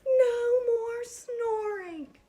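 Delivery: very high, loud, slow drawn-out cry; long, shrill-pitched wail of frustration; exasperated yell. Acted. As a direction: scream it like a mom at the end of her rope. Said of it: "she yelled".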